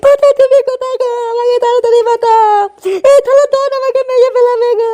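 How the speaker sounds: high, wailing pleas to be saved; drunken cries for help. Acted. Drunk friend wailing to be rescued from the don, in a high voice. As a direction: cry out as if they're about to kill you.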